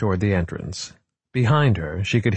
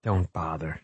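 soft, slightly lowered voice; quiet; 3 semitones lower, 6 dB quieter than usual. A narrator's reading. Margot consoles Frock after the rebuff, quiet. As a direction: say it softly, giving up. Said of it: "she said quietly".

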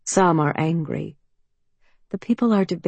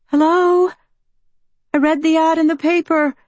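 put-on higher, louder, pleading young woman; anxious young woman; 11 semitones higher, 6 dB louder than usual. A narrator's reading. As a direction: call out, nervous and hopeful. Mallory calling through the door.

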